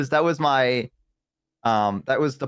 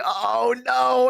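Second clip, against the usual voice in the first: higher, drawn-out "oh, no"; mock dread, pitched up; acted dismay; 10 semitones higher than usual. Caller hearing the clerk sigh, acted high-pitched dismay.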